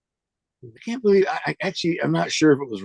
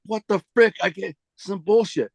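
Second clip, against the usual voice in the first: high-pitched retold exasperation; high, indignant complaint, retold; retold frustration; 7 semitones higher than usual. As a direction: act out being fed up, pitch up. Retelling frustration at refused change.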